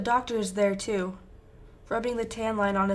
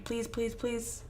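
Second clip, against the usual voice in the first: quiet pleading; 7 dB quieter, slower than usual. A narrator's reading. Desperate pleading after shrieks.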